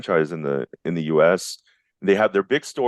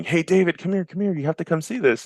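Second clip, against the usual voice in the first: dad calling out, pitched way up; retold excited yell; 12 semitones higher than usual. Dad summons his kid to the TV, mock yell. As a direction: imitate a high, urgent call to a child.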